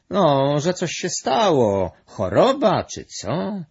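high, loud, drawn-out guess; puzzled guessing. A narrator's reading. Gluś guesses why his friend kicked him, puzzled. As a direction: guess slowly, pitch and volume up.

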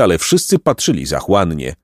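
ordinary delivery

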